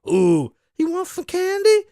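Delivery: put-on high, sing-song creep luring a child; creepy candy offer. Mocking the killer luring kids, high creepy coaxing. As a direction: coax like a creepy stranger offering sweets.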